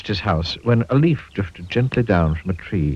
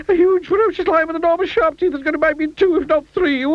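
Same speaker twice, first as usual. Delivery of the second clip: panicky squeak. A narrator's reading. Mister Jelly imagines a lion.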